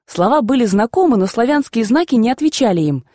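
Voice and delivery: ordinary delivery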